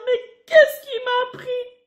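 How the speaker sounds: hammed-up remorseful whimper; tearful regret.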